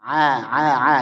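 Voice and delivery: "A" repeated as an open thin ain. Shown as a demonstration. Teacher drills the thinning, thin "A". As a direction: keep each "A" light and unrounded.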